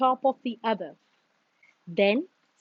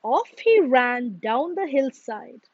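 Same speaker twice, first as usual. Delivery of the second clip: usual speaking voice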